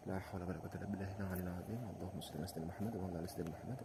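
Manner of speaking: hushed fast prayer; quick murmured prayer. Real, not acted. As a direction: rattle off the prayer under breath. Quick prayers at haunted spot.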